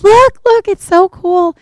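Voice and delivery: high, loud, excited pointing out; excited call. Retold or imitated. Excited boat passengers pointing dolphins.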